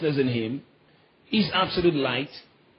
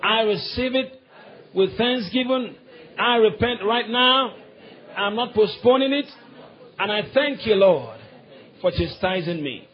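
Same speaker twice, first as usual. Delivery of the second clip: higher, earnest prayer voice; grateful confession; 6 semitones higher than usual. Shown as a demonstration. Preacher modelling a believer's thankful prayer, higher. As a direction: pray it out as a thankful child. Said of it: "I say".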